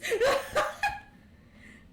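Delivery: high, loud laughter rolling on; real laughter, high and loud. Real, not acted. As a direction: keep cracking up for real. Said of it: "Whatever that means".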